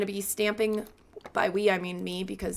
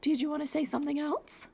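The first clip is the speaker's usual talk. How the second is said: high, softer cooing question; 7 semitones higher, 5 dB quieter than usual. Real, not acted. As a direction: ask the cat sweetly. Talking to meowing cat.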